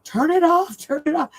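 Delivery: high panicked pleading, repeated; mock panic. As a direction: squeal it like a frightened kid. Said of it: "they're like, you know".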